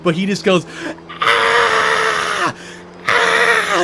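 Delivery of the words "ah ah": very high, drawn-out mock scream; freaked-out scream. Imitating the man with oil on his face, freaked-out scream. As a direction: shriek like a man with burning eyes. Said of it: "freaking out".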